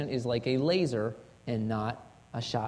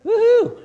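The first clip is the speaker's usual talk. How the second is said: loud, high mock cheer; sarcastic whoop; 14 dB louder than usual. Shown as a demonstration. Preacher mocks a weak confession with a high mock cheer. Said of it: "how powerful is that".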